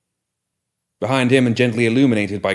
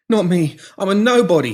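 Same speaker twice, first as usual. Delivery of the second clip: much higher, louder frightened babble; panicked babbling; 10 semitones higher, 5 dB louder than usual. Acted. Pinky babbling to the killer, terrified. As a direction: gabble it out to save yourself. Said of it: "he babbled".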